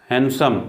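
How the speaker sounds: हैंडसम as "han-sum", no d; silent D. Shown as a demonstration. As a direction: leave the d out of हैंडसम. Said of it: "डी यहाँ silent है". (हैंडसम में डी silent)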